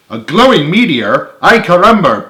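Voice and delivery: startled outburst building to a loud peak; excited cry. A narrator's reading. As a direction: cry out in amazement, voice jumping up. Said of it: "Pedro cried".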